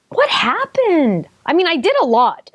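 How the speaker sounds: high, drawn-out incredulous question; dismayed disbelief. Real, not acted. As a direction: stretch it out slowly in high-pitched dismay. Dismayed at her time.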